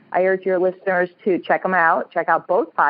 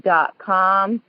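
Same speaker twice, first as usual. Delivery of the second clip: slow web address; 3 semitones higher, much slower than usual. Real, not acted. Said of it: "I have a website".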